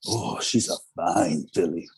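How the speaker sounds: slow, low "Oh, she's a fine filly" in a mock New York drawl; old-timey New York cop. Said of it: "Oh, yeah".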